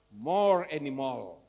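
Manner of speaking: slow, heavy and loud; dragged-out emphasis. Shown as a demonstration. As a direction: lean hard on every word. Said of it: "emphatic repeat of the key phrase".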